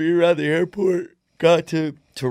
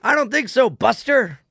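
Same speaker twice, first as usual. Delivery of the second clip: put-on sassy old showman; 10 semitones higher, 7 dB louder than usual. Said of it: "I go".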